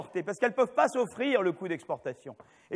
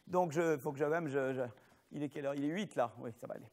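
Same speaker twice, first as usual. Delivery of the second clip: low, soft thinking aloud; quiet aside to self; 8 semitones lower, 9 dB quieter than usual. Real, not acted. Lecturer checking the time mid-class, quiet aside to self. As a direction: drop right down, as if talking to yourself.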